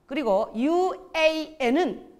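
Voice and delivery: ordinary delivery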